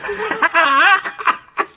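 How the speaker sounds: high, shrill mad cackle; a crazed old woman's laugh; terrifying laugh. A narrator's reading. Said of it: "her terrifying laugh of old".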